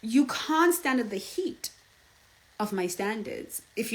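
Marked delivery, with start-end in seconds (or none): none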